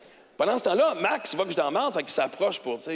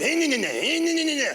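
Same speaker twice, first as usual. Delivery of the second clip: high whining engine noise; revving moto noise; 7 semitones higher than usual. Retold or imitated. The motorcycle rolling into his car, whining engine noise. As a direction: mimic a little motorbike grinding on.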